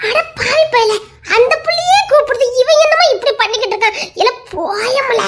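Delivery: high, loud telling-off; exasperated scolding. Acted. Scolding the lad for ignoring the girl, exasperated.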